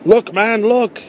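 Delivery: high, alarmed exclamation at normal volume; urgent cry. A narrator's reading. Artilleryman calling the narrator to look, urgent cry. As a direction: cry out to make him look, pitch leaping up. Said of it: "he cried".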